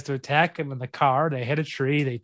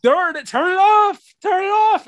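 high, loud frantic shouting; imitated panicked plea; retold urgent yell; 8 dB louder than usual. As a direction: yell it like caught up in a film. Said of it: "I was in it. I was like".

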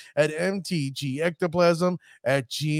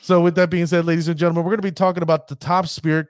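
announcer segue; 3 semitones higher, 5 dB louder, faster than usual. Acted. Host segment announcement.

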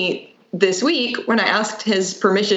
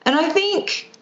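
put-on high little-boy voice; imitating a child; 8 semitones higher than usual. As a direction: play the kid explaining himself. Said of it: "he said".

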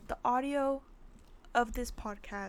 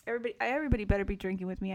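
rattled off very fast; rushed; 2 semitones lower, much faster than usual. Real, not acted. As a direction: hurry through it. Rallying listeners to drink.

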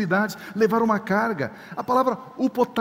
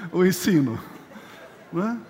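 laughing; 3 semitones lower than usual. Real, not acted. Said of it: "Estou brincando assim com vocês".

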